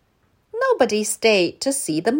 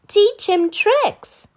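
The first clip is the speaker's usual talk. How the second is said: put-on high, slow child voice; eager children; 13 semitones higher, 5 dB louder, slower than usual. A narrator's reading. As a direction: ask like a pleading kid. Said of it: "said the children".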